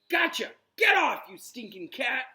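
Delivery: loud, high shout; triumphant shout. Acted. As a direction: yell in triumph. Ron catching the rat.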